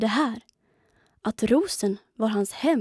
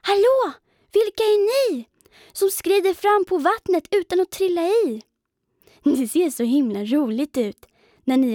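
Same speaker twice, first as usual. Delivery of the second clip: high, louder small-spider voice, curious and eager; little spider calling out; 8 semitones higher, 4 dB louder than usual. A narrator's reading. Pindel calls to the water striders, high eager voice. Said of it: "såg han någonting".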